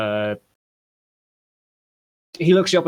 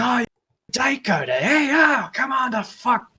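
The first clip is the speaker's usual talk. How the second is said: high, loud shout of outrage; angry shouting; 12 semitones higher, 4 dB louder than usual. Acted. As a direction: yell abuse at someone walking up. Thug yells at approaching woman.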